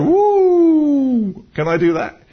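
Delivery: high, loud whoop then a nervous question; a high whoop of alarmed delight; excited whoop. Retold or imitated. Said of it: "And you go".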